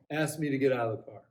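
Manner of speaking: ordinary delivery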